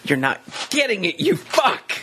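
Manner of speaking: high-pitched, strained mock outburst; exasperated retort. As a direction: pitch climbing sharply into the curse. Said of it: "And I was like".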